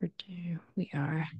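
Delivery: unvoiced whispered demo. Shown as a demonstration. Teacher whispering the reduced form to students. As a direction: breathe the reduced word out softly.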